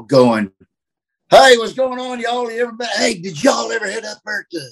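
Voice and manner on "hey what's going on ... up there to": put-on Southern accent. Easing into a crowd of people, high Southern accent. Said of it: "I just naturally talk that way".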